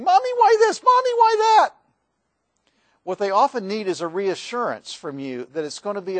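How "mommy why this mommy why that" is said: put-on high, loud child's voice pleading with Mommy; much higher, louder kid's whine; whiny child. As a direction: mimic a child yelling at a parent. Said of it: "your kids come in and they're yelling and screaming".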